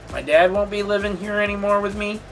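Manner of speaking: normal talking voice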